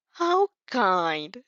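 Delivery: dry, unimpressed, lightly voiced; deadpan. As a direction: say it dry, no warmth at all. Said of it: "you deadpan".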